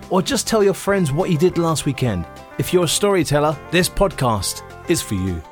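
ordinary delivery

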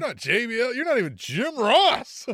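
building to a loud, high mock taunt; mock tirade. Co-host mocking Hangman as Jim Ross, loud building taunt. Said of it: "you could have said".

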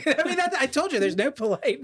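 embarrassed high laughing protest. Real, not acted. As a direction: pitch jumps high through giggles.

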